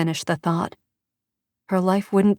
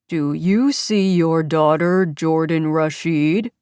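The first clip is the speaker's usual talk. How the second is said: slow, menacing distorted voice; a kidnapper speaking through a voice changer; sinister voice-changer delivery, drawn out; mechanically distorted voice; slower than usual. Acted. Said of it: "the mechanically distorted voice asked".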